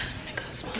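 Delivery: breathy whisper as a secret aside. Real, not acted. Whispering to viewers while getting ready. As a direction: drop to a whisper for the naughty bit. Quiet aside about smoking.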